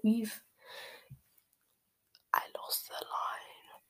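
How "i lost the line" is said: very soft, slow whispered admission; real hushed aside, barely audible; murmured aside. Real, not acted. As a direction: murmur it under your breath. Reader loses place mid-reading.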